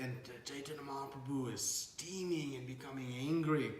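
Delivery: ordinary delivery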